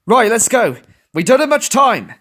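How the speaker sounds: high, loud urgent order; rally the group to move now; commanding. A narrator's reading. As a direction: leader giving a quick order. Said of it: "Plucky commanded the others".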